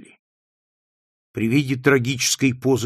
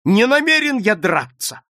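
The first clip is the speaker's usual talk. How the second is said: high, loud insistent cry; shouting; 9 semitones higher, 7 dB louder than usual. A narrator's reading. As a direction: shout the refusal out. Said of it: "крикнул он". (настойчивый крик)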